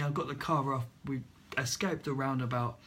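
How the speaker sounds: ordinary talk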